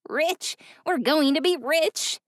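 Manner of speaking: high whiny excited voice; whiny excitement. A narrator's reading. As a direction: squeal it with greedy glee. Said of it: "A whiny, pitched laugh".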